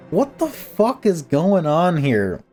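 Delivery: ordinary talk, nothing marked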